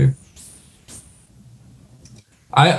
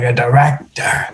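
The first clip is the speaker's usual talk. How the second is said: mock whisper; 3 semitones lower, 4 dB quieter than usual. Drunk sheriff mocking narrator.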